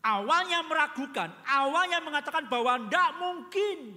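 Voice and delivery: preacher raising his voice over the doubt; forceful pulpit emphasis; emphatic preaching. Real, not acted.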